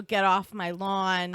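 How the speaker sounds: ordinary delivery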